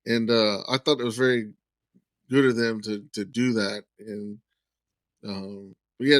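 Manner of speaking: ordinary talk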